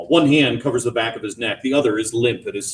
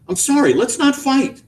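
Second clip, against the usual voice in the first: pleading; 14 semitones higher, 3 dB louder than usual. A narrator's reading. Husband pleading with wife to stop fighting. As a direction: make peace with her.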